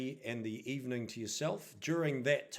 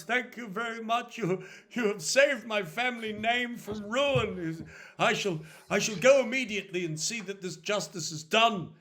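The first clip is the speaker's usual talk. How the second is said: high, gushing, flustered thanks; obsequious grateful nobleman; 7 semitones higher, 6 dB louder than usual. Acted. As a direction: fawn and fumble over the titles.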